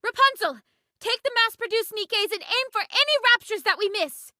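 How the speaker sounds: rally the squad into the charge; battle orders. Acted. Commander giving battle orders.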